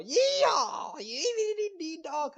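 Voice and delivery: whooping, high and loud hammed-up American cowboy; mock American accent. Mocking Americans on a podcast, hammed-up American cowboy accent. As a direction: yell it like a burger-crazed cowboy parody of an American accent. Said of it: "After the American accent, of course".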